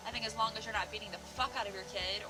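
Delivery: ordinary talk, nothing marked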